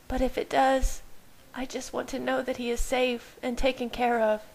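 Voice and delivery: put-on pleading for her baby's safety; Bella, worried. Narrator voices Bella.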